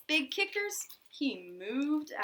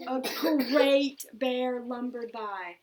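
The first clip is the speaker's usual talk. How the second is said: slow, heavy, louder read of the bear lumbering; 7 dB louder than usual. A narrator's reading. Reading a bear lumbering to a child, slow and heavy. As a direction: draw it out like a big bear.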